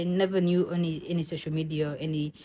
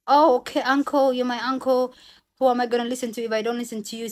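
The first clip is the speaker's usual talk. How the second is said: higher, louder, sweetly compliant; girl pretending to obey her uncle, higher and louder; mock obedience; 5 semitones higher, 4 dB louder, faster than usual.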